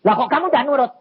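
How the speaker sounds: loud, high demand to be obeyed; angry prophet. Retold or imitated. Angry prophet scolds the man who won't obey, loud. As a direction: imitate a prophet scolding indignantly. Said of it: "Nabi ini marah".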